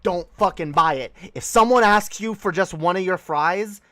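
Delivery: ordinary talk, nothing marked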